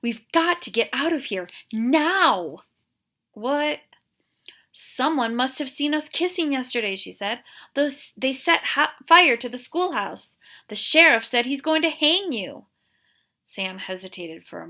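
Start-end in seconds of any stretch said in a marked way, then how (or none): 0.0-2.6 loud high urgent plea. Acted. 3.3-3.8 startled. Acted. Sam startled. 4.7-12.7 loud high anxious rush; urgent. Acted. Catherine urgent warning.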